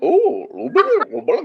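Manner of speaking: squeaky space-creature babble; alien voice. Pretend. Host imitating a future alien watching the footage, squeaky. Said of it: "be like".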